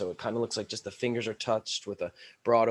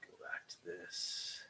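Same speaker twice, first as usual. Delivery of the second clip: whispering; 5 semitones lower, 17 dB quieter, slower than usual. Real, not acted. Aside fixing the camera.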